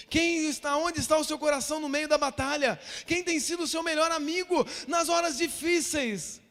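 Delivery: loud, impassioned pulpit delivery; full-force preaching. Real, not acted. Pastor urging the congregation about family and God, impassioned preaching. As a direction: drive each phrase home to the congregation.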